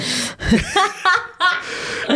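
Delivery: gargalhada aguda e solta; risada alta. Real, not acted. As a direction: rir alto do próprio orgulho. Lembra que fazia bem o diabo. (risada alta e aguda)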